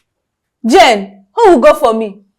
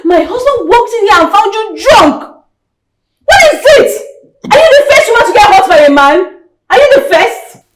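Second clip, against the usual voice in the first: furious berating; 4 semitones higher than usual. Acted. Sister berates heartbroken sibling.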